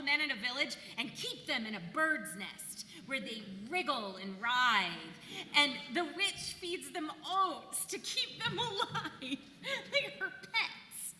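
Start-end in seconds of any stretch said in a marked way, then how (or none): 5.6-11.1 laughing. Real, not acted. Giggling at the tale.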